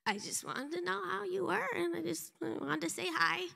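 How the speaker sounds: high, sheepish little voice; timid, meek. Retold or imitated. Awkward woman answering an angry older stranger, timid and meek. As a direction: imitate an awkward, intimidated explanation.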